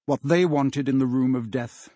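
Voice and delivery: usual speaking voice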